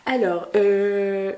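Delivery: ordinary delivery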